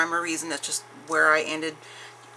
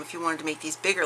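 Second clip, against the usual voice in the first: ordinary talk, nothing marked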